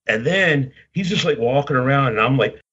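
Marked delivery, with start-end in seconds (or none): none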